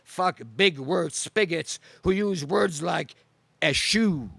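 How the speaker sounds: ordinary talk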